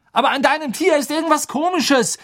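high, agitated retort; heated accusation. A narrator's reading. Ron streitet mit Hermine. (aufgebracht beschuldigend)

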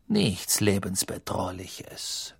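slow, soft, whispered menace; cold menacing teacher. A narrator's reading. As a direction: hiss it with icy contempt. Snape voice.

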